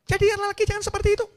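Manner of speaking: high, fast falsetto mock wife; husband hears his wife scolding him, high fast mock nag; nagging wife. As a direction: mimic a shrill-voiced nagging wife, quickly. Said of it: "mulai timbul teriakan-teriakan".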